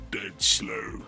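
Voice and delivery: normal talking voice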